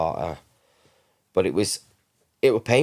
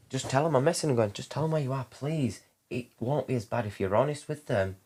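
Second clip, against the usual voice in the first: higher, softer, urging voice; gently plead, pitched up and hushed; retold plea; 5 semitones higher, 4 dB quieter than usual. Father urging his son's mum to say where she is, soft pleading.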